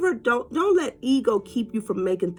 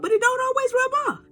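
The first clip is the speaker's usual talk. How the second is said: ordinary talk, nothing marked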